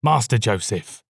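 ordinary delivery, nothing marked

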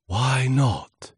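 high, swooping gusty question; voice of the wind. Acted. Wind questioning the timid leaf, swooping voice. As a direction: let the words swoop like wind.

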